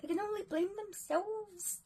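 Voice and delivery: put-on high-pitched, amateur Australian accent; attempted Australian accent. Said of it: "trying to do an Australian accent".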